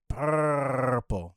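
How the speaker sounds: 'purple' with a long trilled R; rolled-R purple. Retold or imitated. Co-host copying the host's roll, trilled 'purple'. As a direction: trill PURRR in 'purple', stretched out. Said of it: "is that how you're doing it?".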